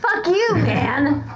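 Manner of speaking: venting at a columnist he hates; angry outburst. Real, not acted. Host's guest rants at the columnist, angry outburst.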